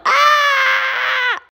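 long high loud scream; drawn-out high "aah!". Real, not acted. Woman under attack by her dog, long scream.

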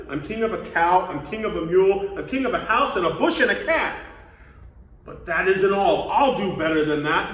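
loud, royal bluster; thundering. Acted. Turtle king puts lowly Mac in his place, thundering. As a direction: boom it out like a boastful king. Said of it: "his royal voice thundered".

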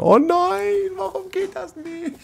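oh no in a very high wail; high despairing wail. Real, not acted. Gamer watching his base collapse, high dismayed wail.

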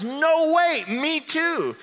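very high, loud, hammed-up squeal of delight; ecstatic disbelief. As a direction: play it thrilled beyond belief. Joke man's delight peaks.

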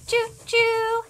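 high, sustained train hoot; train whistle imitation. Shown as a demonstration. Showing a toddler a train sound, high whistle. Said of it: "Can you do that?".

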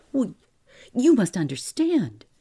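high, loud, anxious appeal; flustered pleading. A narrator's reading. Clerk backtracking before his laughing employer, flustered pleading. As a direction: scramble to explain yourself.

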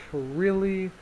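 retold, incredulous question; mock disbelief. Retells opponent's dismay at replay.

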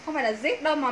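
ordinary talk, nothing marked

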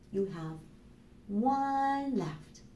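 usual speaking voice

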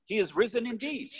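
high, emphatic liturgical reply; emphatic congregational response. Retold or imitated. Church answering the pastor's Easter greeting, emphatic and high. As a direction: answer in kind, pitched well up. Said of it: "they responded in kind".

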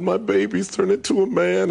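a touch of a tearful mom; mother marvelling at her son; mother quoted. Retold or imitated.

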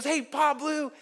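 high, bright girl's greeting, lightly voiced; imitating a bubbly girl. Camper girl greets the pastor at camp, light high voice. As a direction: play the cheerful camper calling out. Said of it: "And she goes".

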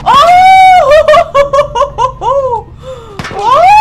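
loud high "oh" breaking into laughter; shriek, then laugh it out loudly. Real, not acted. Gamer losing control of the fight, laughing shriek. Shrieks during game attack.

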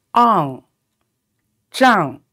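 zhang dropping hard; falling zhang. Shown as a demonstration. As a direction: drop the tone on zhang. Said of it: "You got to drop the tone".

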